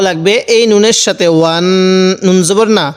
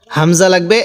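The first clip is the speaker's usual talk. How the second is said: ordinary talk, nothing marked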